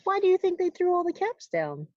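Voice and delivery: ordinary delivery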